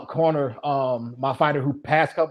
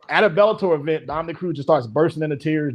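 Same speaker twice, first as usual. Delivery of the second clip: ordinary delivery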